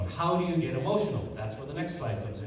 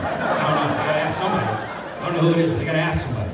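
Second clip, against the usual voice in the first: laughing stalling client; 7 dB louder, much faster than usual. Retold or imitated.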